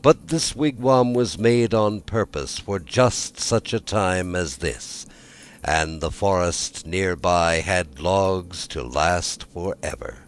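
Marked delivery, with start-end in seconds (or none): none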